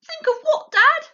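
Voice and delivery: put-on small fox child voice. A narrator's reading. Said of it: "small fox asks".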